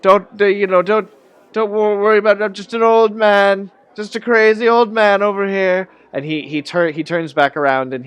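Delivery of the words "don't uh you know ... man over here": put-on high, loud, pleading old man voice; a jittery old drunk begging to be left alone; frightened old man insisting he's harmless; nervous old man. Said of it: "He's like".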